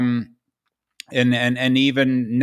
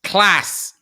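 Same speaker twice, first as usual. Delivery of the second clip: imitating Yorkshire; 5 semitones higher than usual. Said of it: "Say that word again".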